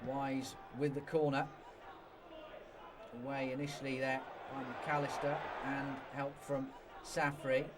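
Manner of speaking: usual speaking voice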